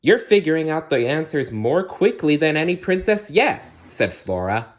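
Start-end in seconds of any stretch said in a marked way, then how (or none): 0.0-3.6 high, louder delighted fairy; high fairy praise. Acted. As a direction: gush like a proud little fairy. Fairy Flora praises princess.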